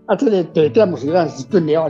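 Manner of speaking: ordinary talk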